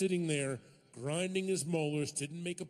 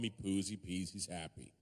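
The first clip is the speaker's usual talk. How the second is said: ordinary talk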